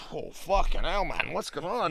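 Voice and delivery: high, loud startled whoa; mock alarm at being recognised; caught off guard. Recalling a stranger bouncing out of his car, startled and loud. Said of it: "So I was a bit like".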